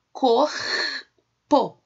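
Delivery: "Corpo" in two loud, separate syllables, cor, po; sílaba por sílaba. Shown as a demonstration. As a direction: break "Corpo" after a drawn-out cor. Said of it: "quando eles se separam". ("Corpo" bem separado em cor e po)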